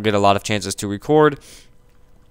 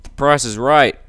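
excited intro; 5 semitones higher, 7 dB louder than usual. Acted. Opening greeting of the show.

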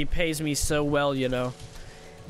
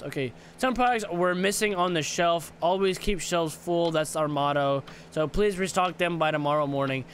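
ordinary talk, nothing marked

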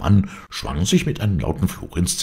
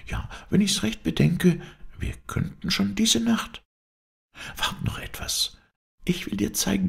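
put-on high-pitched, pleading girlish voice; earnest young woman confiding; 6 semitones higher than usual. Heroine asking her lover for a great service, earnest. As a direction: play the heroine urging her lover, higher than narration.

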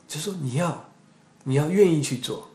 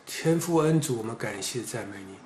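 hushed prayer; 2 semitones lower, 8 dB quieter than usual. Real, not acted. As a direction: pray quietly and humbly to God. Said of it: "让各位弟兄姐妹看看什么是真的方言".

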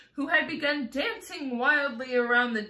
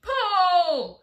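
high, loud heave-ho shout; 8 semitones higher, 9 dB louder than usual. A narrator's reading. Grasshopper rallies everyone on the rope, shouting. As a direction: yell the command, stretched out. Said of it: "shouted the gr-, old green grasshopper".

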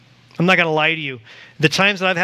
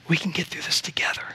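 soft unvoiced whisper; hushed promise to the listener; 6 dB quieter than usual. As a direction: breathe it gently as God's comfort. Whispered voice of God.

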